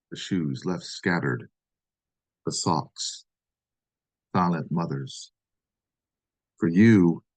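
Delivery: measured poetry reading with weighty pauses between phrases; poem reading. Acted. Poet reading an angry love poem to America, intoned poem reading. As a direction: read it as an angry love poem, leaning into each "For you".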